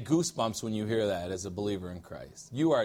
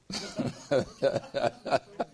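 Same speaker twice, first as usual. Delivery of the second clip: breathy laugh. Real, not acted. Laughs at own joke.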